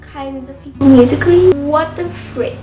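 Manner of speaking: loud outraged yelling; calling out a troll. Pretend. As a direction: shout back at the hater.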